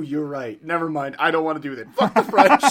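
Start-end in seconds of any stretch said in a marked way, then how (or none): 1.9-2.6 loud mock outburst.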